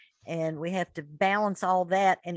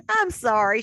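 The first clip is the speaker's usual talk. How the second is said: laughing; 7 semitones higher than usual. Real, not acted. Laughs after catching Penny eating.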